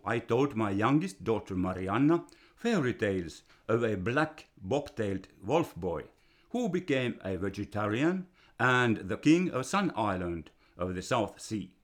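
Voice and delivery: usual speaking voice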